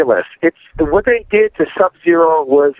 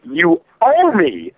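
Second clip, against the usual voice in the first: slow emphatic repeat; 4 semitones higher, much slower than usual. Acted. Telling NetherRealm directly, slow and drawn-out insistence. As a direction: drag out each word, heavy and deliberate.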